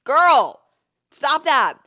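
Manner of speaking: loud, high call to cut it out; scolding shout. Retold or imitated. Narrator calling off her flirting friend, scolding shout. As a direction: sharply yell at a friend.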